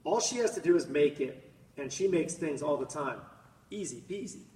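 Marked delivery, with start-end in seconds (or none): none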